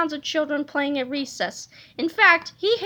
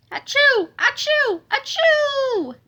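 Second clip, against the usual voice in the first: high, loud string of sneezes; repeated sneezes; 10 semitones higher, 4 dB louder, slower than usual. Acted. Cheerleaders unable to stop, sneezing high and loud. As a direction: sneeze over and over, helplessly.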